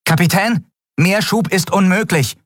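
high-pitched, urgent protest; urgent report. A narrator's reading. Engineer answers the captain over the comm, urgent. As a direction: report the bad news under pressure.

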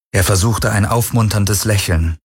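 usual speaking voice

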